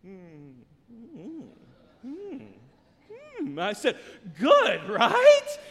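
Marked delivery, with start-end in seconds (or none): 0.1-3.6 very soft, hesitant mms rising in pitch; mock dawning approval, wordless; imitated tasting hum. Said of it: "they find something they like". 4.4-5.6 loud, high-pitched "told you so"; hammed-up excited check with son; triumphant dad. Dad triumphant after son likes it.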